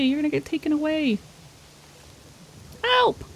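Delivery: high desperate pleading, ends in a cry for help; frantic begging. Real, not acted. Begging the runaway cat.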